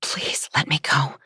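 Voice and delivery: soft, frightened whisper; whispered plea. A narrator's reading. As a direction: beg under your breath. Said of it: "as I whisper".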